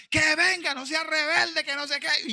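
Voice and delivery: high and loud, pressing her to come; won't take no for an answer; insistent call. Retold or imitated. The preacher insists the rebellious girl come forward, loud.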